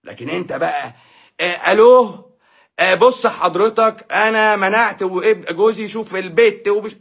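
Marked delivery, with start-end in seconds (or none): 1.4-7.0 higher, slow put-on woman's voice on the phone; mock female caller. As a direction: play a hesitant wife phoning a sheikh. Acts a woman calling a sheikh.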